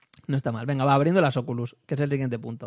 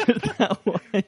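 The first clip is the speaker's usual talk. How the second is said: laughing through the words; high, slow laughing repeat; 7 semitones higher, much slower than usual. Real, not acted.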